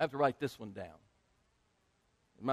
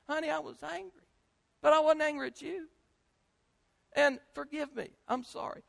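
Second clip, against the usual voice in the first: high, softened pleading voice; tender apology; 13 semitones higher than usual. Acted. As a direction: gently ask a child's forgiveness. Models a parent's apology to child.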